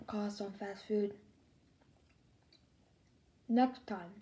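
fingers at lips; chewing between words; talking while eating. Real, not acted. Seen 2: Hand with food at lips, chewing throughout labelled words.

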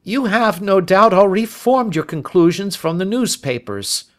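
high, condescending inspector voice; loftily patronising; indulgent. A narrator's reading. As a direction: humour him, sure of being right. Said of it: "Lestrade laughed indulgently".

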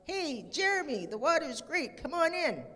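lightly voiced girl calling her friend in. A narrator's reading. Stella calling Jeremy from the pond, high excited call. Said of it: "she cried".

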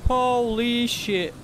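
loud, high, drawn-out cry of shock; slow, high shocked yell; shocked outburst. Real, not acted. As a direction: stretch each word in stunned alarm. Startled by sudden game event.

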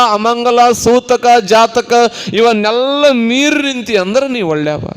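high, ringing sermon voice; impassioned preaching. Real, not acted. Preacher urging devotees to rise above birth and death, impassioned.

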